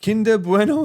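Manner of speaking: high, surprised read-out of the name; sudden high amused exclamation; amused surprise. Real, not acted. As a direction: blurt the name in disbelief. Reading a funny drink name.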